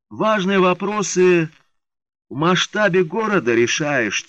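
slow, drawn-out high drawl. Acted. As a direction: stretch every word lazily. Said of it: "тягучим голосом произнёс".

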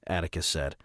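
usual speaking voice

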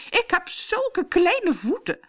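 high-pitched, drawn-out bragging; excited boast. A narrator's reading. Bertha boasting to her sister, excited. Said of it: "rood aan van opwinding".

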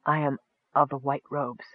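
soft, weakened elf; hushed, with little strength left; weaker voice. A narrator's reading. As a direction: say it faintly, after a painful cough. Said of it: "then continued in a weaker voice".

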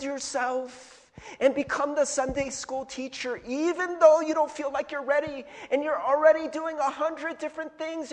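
exaggerated teary elder pressing a volunteer; mock guilt-trip. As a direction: lay on the guilt with hammed-up tearful pity. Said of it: "They're like".

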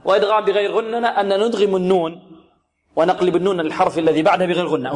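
ordinary talk, nothing marked

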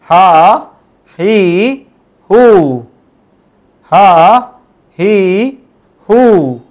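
each syllable said slowly and drawn out in a chanting drill; drilled Persian long vowels. Shown as a demonstration. Teacher drills the class on long vowels, chanting each one. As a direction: model the long vowels one at a time for students. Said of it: "تو یہ تھے ہمارے long vowels".

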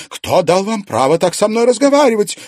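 offended lady demanding respect; indignant outrage. A narrator's reading. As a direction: choke with outrage. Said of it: "задохнулась от возмущения Алена".

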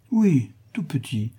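coaxing, drawn out, starting high; câline. A narrator's reading. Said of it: "répondit câlinement Julie". (ton câlin)

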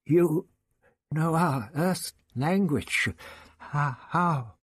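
timid, faltering question; trembling a little. A narrator's reading. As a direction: muster calm but tremble slightly. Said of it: "trembling a little".

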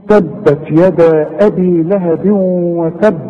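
drawn-out recitation with doubled, bounced ب closing وتب; strong qalqalah. Shown as a demonstration. Teacher showing students how the ba behaves, heavy bounce on وتب. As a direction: hold then pop the b in وتب. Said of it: "شوف البه ده عاملة إزاي؟".

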